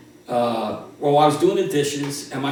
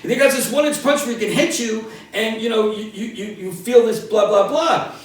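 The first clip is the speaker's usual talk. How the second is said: mom explaining; 7 semitones higher, 4 dB louder than usual. Retold or imitated. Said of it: "And my mom goes".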